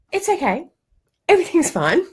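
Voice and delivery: high, sing-song fake reassurance; mock reassuring parent. Parent telling the child, high mock cheerful reassurance. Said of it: "parents saying things like".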